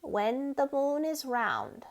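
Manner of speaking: slow, solemn lizard voice; the magic lizard answering the mouse, slow and solemn. Acted. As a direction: pronounce it like an ancient spell.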